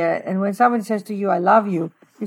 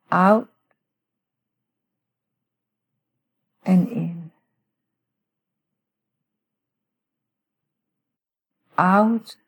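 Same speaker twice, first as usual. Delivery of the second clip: guided meditation. Shown as a demonstration. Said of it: "let me just walk through a little prayer with our listeners".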